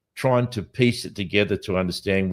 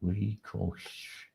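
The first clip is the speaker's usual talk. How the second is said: ordinary talk, nothing marked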